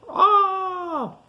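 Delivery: high, hammed-up scream of fright; a playful high yelp as the creature drops; mock scream. Voicing Mister Biggs on the roller coaster for kids, mock scream.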